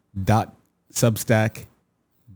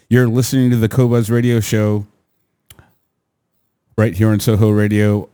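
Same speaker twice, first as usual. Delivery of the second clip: bright, louder radio host voice; radio announcer; 2 semitones higher, 5 dB louder than usual. Acted. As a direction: announce the station with punch. Radio show station ID.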